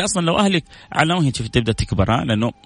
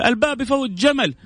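dismissive husband; 10 semitones higher than usual. Acted. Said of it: "يجي يقول".

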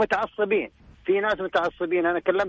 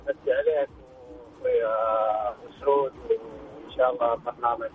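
distant speakerphone voice; phone on speaker; 7 semitones lower than usual. Real, not acted. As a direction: voice coming through a phone speaker. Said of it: "الصوت عندك يقطع، بس الظاهر أنك في خط، لو تمسك لي الجوال أفضل من السبيكر".

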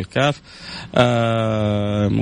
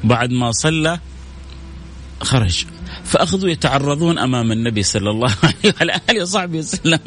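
ordinary delivery

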